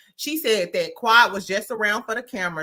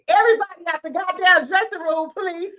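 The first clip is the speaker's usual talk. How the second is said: mock shout; 8 semitones higher, 5 dB louder than usual. Doctor Jackie ordering everyone out, mock shout. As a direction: clear the room, sugar-coat the ending. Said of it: "this is what Doctor Jackie was giving".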